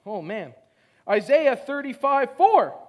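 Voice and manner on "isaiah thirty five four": slow, loud call of the verse reference; loud announcement. Real, not acted. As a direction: proclaim it to the hall. Preacher announces verse loudly.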